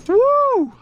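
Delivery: loud, high whoop; whooping at the catch; joyful whoop. Real, not acted. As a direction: let out a triumphant woo. Celebrating the catch.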